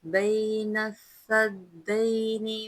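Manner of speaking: slow chanted verse with long vowels; tajweed recitation. Shown as a demonstration. Teacher reciting the verse for students, slow melodic chant. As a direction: chant each word slowly and melodiously. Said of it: "आयत को एक मरतबा दोबारा".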